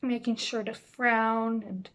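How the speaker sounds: frowning, slowed right down; low and slow. Shown as a demonstration. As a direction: drag it slowly with a frown. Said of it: "speak kind of low and slow".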